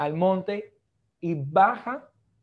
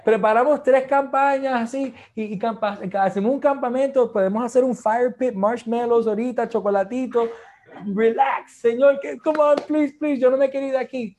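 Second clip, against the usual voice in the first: eager Peter; 6 semitones higher, 6 dB louder than usual. Acted. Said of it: "y Pedro dice".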